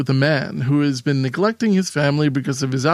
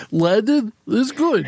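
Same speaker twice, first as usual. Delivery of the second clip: put-on Russian accent; 6 semitones higher, slower than usual. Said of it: "a Russian kind of guy".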